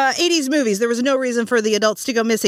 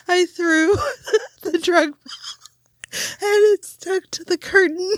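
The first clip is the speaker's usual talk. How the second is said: high, breaking laughter; laughing hard; 10 semitones higher than usual. Real, not acted. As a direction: laugh through the words. Laughing over the trick shot.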